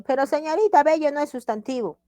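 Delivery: high-pitched pupil protest; acted student objection; objecting student. Voices student objecting to the teacher.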